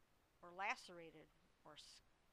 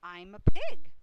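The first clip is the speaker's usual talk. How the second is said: loud gleeful shout; Avery's cry; 27 dB louder than usual. A narrator's reading. Boy answering his mother from the crate, loud shout. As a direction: shout it out at full volume. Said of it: "cried Avery".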